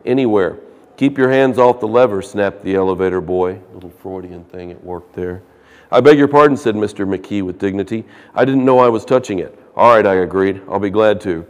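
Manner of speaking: loud, projected reading from the book; reading a novel aloud. Acted. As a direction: read the passage out to the class. Reads the novel passage aloud.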